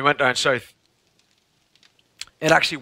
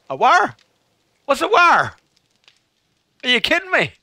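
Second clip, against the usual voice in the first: very high, incredulous outburst; hammed-up bafflement; mock disbelief; 11 semitones higher, 5 dB louder than usual. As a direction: react in loud, high comic astonishment. Hammed-up disbelief at Norfolk speech.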